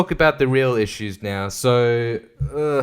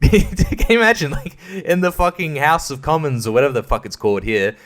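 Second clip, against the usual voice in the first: normal talking voice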